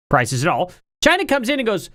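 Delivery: normal talking voice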